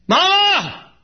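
put-on high shrill-free child's call, loud and high. Lost little boy calling his mama in the store, loud and high. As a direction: cry out for a lost mother.

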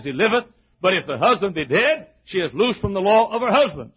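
declaimed King James verse, emphatic cadence; ringing pulpit recitation; scripture reading. Real, not acted. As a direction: read the scripture out to a congregation. Reads scripture aloud to congregation.

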